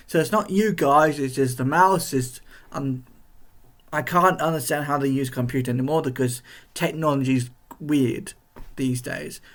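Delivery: normal talking voice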